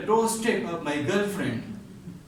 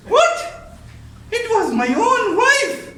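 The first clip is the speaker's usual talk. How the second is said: high, loud shocked cry; shocked reveal; 14 semitones higher, 8 dB louder than usual. Acted. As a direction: blurt it out in shock. Punchline reveal, shocked exclamation.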